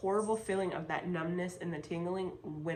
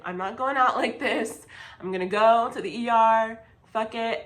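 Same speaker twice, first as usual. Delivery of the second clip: higher and louder, determined outburst; fed-up resolve; 4 semitones higher, 9 dB louder than usual. Retold or imitated. To herself at three am, loudly resolving to go to the ER. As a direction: declare you're done waiting, voice raised. Deciding to go to ER.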